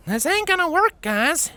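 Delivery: high-voiced pony warning the others; a cautious pony voice, pitched high; cautioning. A narrator's reading. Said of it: "the Earth Pony cautioned".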